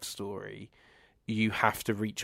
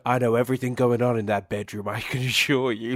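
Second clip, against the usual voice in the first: louder, pushed mock creepy quote; mock creepy line; 7 dB louder than usual. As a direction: ham up a creepy boast. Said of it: "quoting a creepy character".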